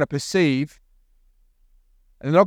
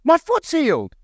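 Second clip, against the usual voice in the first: high, astonished cry, acted out; hammed-up high-pitched amazement; imitated shrieking; 9 semitones higher than usual. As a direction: squeal it like a man who cannot believe it. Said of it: "he shrieked".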